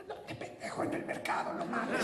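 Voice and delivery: breathless angry hiss; dying hiss. Acted. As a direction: snap at him between gasps. Wife faking dying, hissing.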